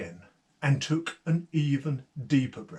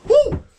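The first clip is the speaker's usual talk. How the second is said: squealing high, much louder whoo of fright; frightened whoo; 9 dB louder than usual. Acted. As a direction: yelp like a startled traveller. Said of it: "would go".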